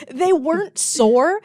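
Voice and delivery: normal talking voice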